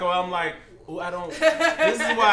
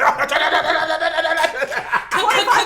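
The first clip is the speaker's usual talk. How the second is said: loud laughing combo sounds; fighting game noises; 10 semitones higher, 5 dB louder than usual. Retold or imitated. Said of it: "She was like".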